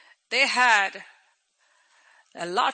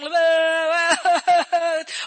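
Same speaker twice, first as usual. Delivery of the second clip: loud, high mock wail; exaggerated drawn-out crying noise; imitated wailing; 5 semitones higher, 5 dB louder than usual. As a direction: mimic a crowd of mourners bawling. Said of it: "with everybody going".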